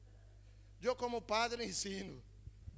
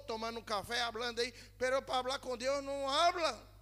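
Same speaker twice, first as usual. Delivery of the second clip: higher, louder, driving sermon voice; fervent preaching; 4 semitones higher, 4 dB louder than usual. Real, not acted. Pastor urging the church to pray, fervent preaching. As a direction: preach it with rising fire.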